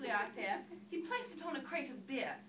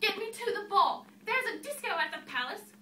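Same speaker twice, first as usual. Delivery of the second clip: demanding, raised higher voice; mildly shouted demand; shouting; 4 semitones higher, 5 dB louder than usual. Acted. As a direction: insist loudly, fist-pounding impatience. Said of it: "She beat her fist against the wall and shouted".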